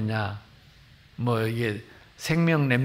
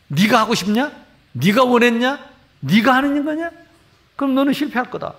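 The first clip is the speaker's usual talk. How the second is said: rising, louder grilling of a student; mock threat; 8 semitones higher, 5 dB louder than usual. Pastor threatening seminary students, mock stern grilling. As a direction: press harder with each question. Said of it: "막 이렇게 협박을 합니다".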